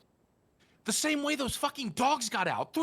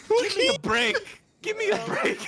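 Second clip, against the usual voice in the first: put-on higher, louder Irish accent, laughing a little on the repeat; 10 semitones higher, 8 dB louder than usual. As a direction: throw on a hammy Irish accent. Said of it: "Are you Irish?".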